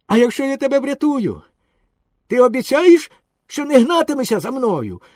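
high, loud bargaining shout; calling out. A narrator's reading. Boy bargains with the drowning dog, calling out loudly. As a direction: call it out high, demanding a promise. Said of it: "пожалів собаку і гукнув".

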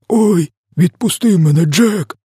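very high, pleading wail; screeching. A narrator's reading. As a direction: beg in a high screech of terror. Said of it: "верещав він". (благальний високий вереск)

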